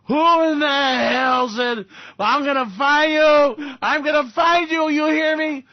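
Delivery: high, loud, slow mock hoarse rant; imitating Trump. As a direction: ham up a ranting hoarse politician. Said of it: "his voice is hoarse".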